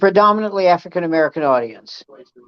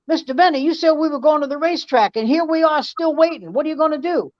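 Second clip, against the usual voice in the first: higher, lively imitation of Rochester's accented voice; Rochester impression; 7 semitones higher than usual. Showing how Rochester really talked to Mister Bennett, high voice. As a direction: do Rochester speaking to his boss. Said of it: "But he said it like this".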